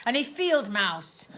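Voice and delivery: plain reading voice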